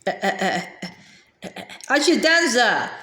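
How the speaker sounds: joyful cry after a little hum; excited exclaim. A narrator's reading. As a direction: burst out happily. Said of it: "exclaimed excitedly to the happy crowd".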